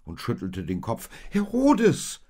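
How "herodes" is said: high, loud exclamation; piercingly raised, drawn-out cry. A narrator's reading. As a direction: call the name out in alarm. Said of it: "rief Maria".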